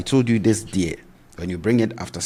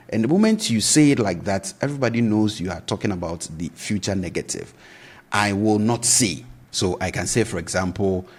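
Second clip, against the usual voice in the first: ordinary delivery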